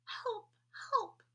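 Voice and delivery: small, high distant cry for help; a tiny doll calling from far off. A narrator's reading. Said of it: "cried another voice".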